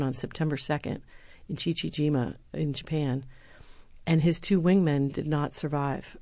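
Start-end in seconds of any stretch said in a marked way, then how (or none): none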